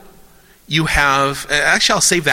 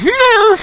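very high, loud mock puppy yelp; high-pitched dog cry, played for laughs; imitated dog yelp; 6 dB louder than usual. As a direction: squeal like a kicked puppy. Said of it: "and it goes".

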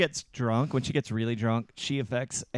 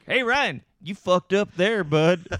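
louder, higher folksy Texan scolding; mock Texan telling-off; put-on Texas accent; 6 semitones higher, 8 dB louder, slower than usual. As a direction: call him out in a big Texas twang. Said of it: "I can talk like that".

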